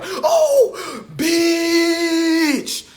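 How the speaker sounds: put-on high, squealing girlfriend voice; hammed-up excited homegirl, high and laughing; homegirl gushing. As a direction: squeal it like you found your twin. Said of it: "your homegirl walking to a homegirl".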